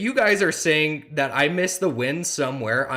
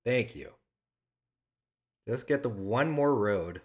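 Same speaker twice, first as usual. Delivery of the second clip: ordinary talk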